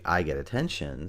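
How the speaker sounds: normal talking voice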